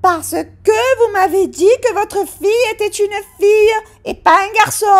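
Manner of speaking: high, loud exasperated answer; she's had enough of him; fed-up waitress. Acted. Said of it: "commençait à en avoir marre".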